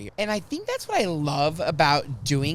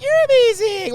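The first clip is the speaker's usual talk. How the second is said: much higher, loud retold gush; gushing compliment; 10 dB louder than usual. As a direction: throw the praise back excitedly. Excitedly returns the compliment.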